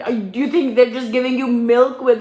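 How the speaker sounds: usual speaking voice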